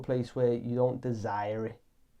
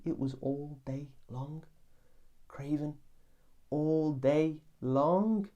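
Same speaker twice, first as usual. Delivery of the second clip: dragged-out moan; 3 semitones higher, slower than usual. Acted. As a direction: drawl it like the craving never ends. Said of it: "have I painted the picture accurately?".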